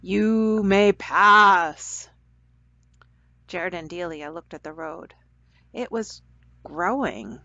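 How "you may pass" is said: very slow, louder announcing voice; a hidden voice grants passage, slow and resonant; deep resonant voice. Acted. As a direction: announce it like an unseen gatekeeper. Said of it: "a deep, resonant voice announced".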